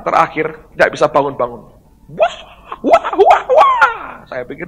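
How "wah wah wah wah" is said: high, loud repeated wails; piercing "wah, wah, wah"; imitated wailing. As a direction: mimic someone screaming over and over. Imitates the last person's screams.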